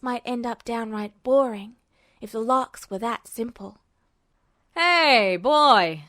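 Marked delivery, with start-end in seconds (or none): 4.7-6.0 loud call across the hall; loud friendly hail from down the corridor. Acted. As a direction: shout to catch the boy's attention. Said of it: "The shout came again".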